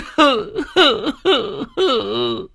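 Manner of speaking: high put-on sobbing; fake sob. Cúc sobs falsely at the funeral, put-on sob. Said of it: "giọng điệu giả nhân giả nghĩa".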